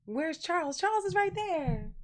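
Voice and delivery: loud, high playful call; high excited calling. Real, not acted. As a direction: sing out loudly as if to a pet. Calls out for Charles, likely pet.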